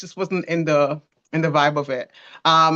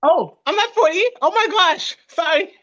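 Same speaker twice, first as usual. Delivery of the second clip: surprised; 12 semitones higher, 4 dB louder than usual. Real, not acted. Wife realizes she misstated age.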